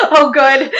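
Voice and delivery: laughing words out. Real, not acted. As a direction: speak while laughing. Laughing at own reaction.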